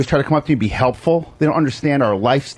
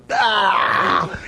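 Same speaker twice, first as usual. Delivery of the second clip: high, drawn-out "aah"; mock yell; 5 semitones higher than usual. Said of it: "so I was just like".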